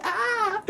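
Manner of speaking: sudden very high squeal; high playful squeal. Real, not acted. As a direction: let out a shrill fun shriek. Playful yell ending the tease.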